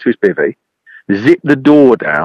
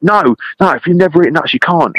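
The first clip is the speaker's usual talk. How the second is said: upset pleading; 9 semitones higher than usual. Retold or imitated. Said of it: "he's getting quite upset with me".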